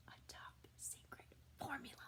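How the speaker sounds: hushed, quick whisper; conspiratorial whisper; secret whisper. Acted. Secret formula in the story.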